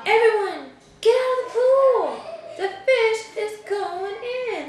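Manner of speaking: high, loud warning shout, slow; shouted storybook warning, laughing at the end; firemen yelling. A narrator's reading. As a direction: bellow to clear the pool. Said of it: "The firemen yelled".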